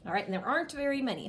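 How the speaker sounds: ordinary talk, nothing marked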